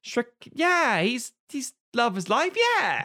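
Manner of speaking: put-on high, giddy kid voice; lightly voiced, breathless young fan; mock child fan. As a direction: gush about Shrek like an excited kid. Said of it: "And kids will go".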